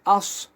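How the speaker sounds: "আস" drawn out with rounded lips and a heavy s; rounded Sad sound. Shown as a demonstration. Teacher drilling Sad with sakin, "আস" said round-mouthed. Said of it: "মুখ গোল করে বলতে হবে".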